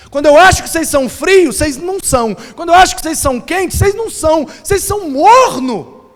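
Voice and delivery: high, rising reproach; exasperated rebuke. Acted. Preacher voicing Jesus rebuking the lukewarm church, exasperated and high. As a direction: scold them with pitch climbing as it builds.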